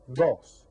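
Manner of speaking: "Dos" said as one loud beat; clapped syllable. Shown as a demonstration. Teacher claps out "Dos" for the class, loud. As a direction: hit "Dos" like a single clap. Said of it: "Clap it out".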